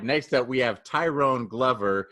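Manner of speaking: usual speaking voice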